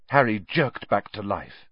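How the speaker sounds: usual speaking voice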